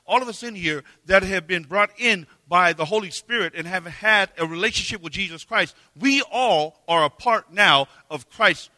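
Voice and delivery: loud, high preaching voice; rally the church to mission; preacher rousing the congregation to mission; preaching at full force. Real, not acted. Sermon rises to full force.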